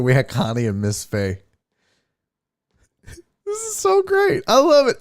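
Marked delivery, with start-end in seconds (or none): 3.1-5.0 laugh-crying delight. Real, not acted. Host loving the chat's crackhead stories, crying with laughter. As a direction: let it squeak out through tears of laughter.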